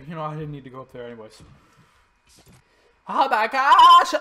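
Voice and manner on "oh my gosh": high, loud choked cry and cough; choking cough. Real, not acted. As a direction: gasp and cough from the burning spice. Spicy coughing fit.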